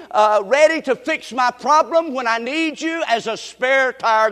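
drawn-out sermon delivery with punched openings; emphatic preaching. Acted. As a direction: preach it as a mock plea to God. Preaches, voicing a mock prayer.

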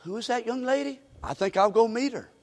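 soft, wondering murmur; thinking aloud, drawn to her; hushed, smitten. Retold or imitated. Boaz deciding to meet Ruth, soft and smitten. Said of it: "I'll tell you what he does".

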